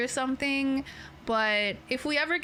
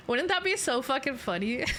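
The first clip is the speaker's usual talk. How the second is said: usual speaking voice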